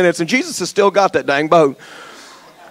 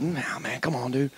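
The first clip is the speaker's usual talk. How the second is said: quiet dismissive aside; 8 semitones lower, 17 dB quieter than usual. Acted.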